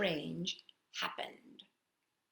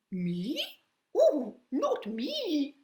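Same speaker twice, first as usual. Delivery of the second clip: pigeon voice; 6 semitones higher, much slower than usual. Acted. Pigeon answers in own voice.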